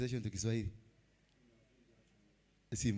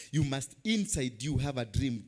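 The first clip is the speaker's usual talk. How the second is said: normal talking voice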